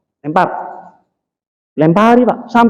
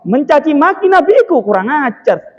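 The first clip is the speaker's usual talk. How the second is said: high, drawn-out outburst of acted outrage; acted seething, pitched high; seething indignation; 7 semitones higher than usual. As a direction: play a man seething at an insult. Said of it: "Keret-keret Sayyidina Abu Bakar ya".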